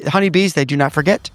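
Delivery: usual speaking voice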